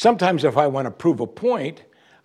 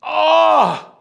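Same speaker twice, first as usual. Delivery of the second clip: high piercing demon shriek; far-off inhuman wail; demon cry; 6 dB louder than usual. Acted. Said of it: "Demon says".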